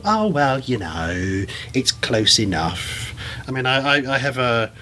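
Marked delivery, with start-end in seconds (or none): 0.1-3.1 mock the lazy shrug of someone who doesn't care; dismissive shrug. Mocking careless people who shrug off wrong science, slow and dismissive. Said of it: "it's not good enough to just go".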